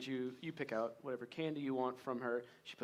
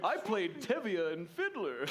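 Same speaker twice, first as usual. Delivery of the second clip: boastful Santa; 5 semitones higher, 3 dB louder than usual. Acted.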